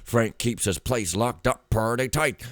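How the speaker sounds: emphatic, forceful character read; note writer in character. Acted. Player reading a note on Frank's locked shop, forceful character voice. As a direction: read it out like a suspicious informant's report.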